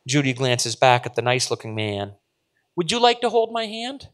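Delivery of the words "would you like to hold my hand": put-on high, innocent child's offer; young girl voice. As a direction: offer it sweetly like a kid. Child offers her hand.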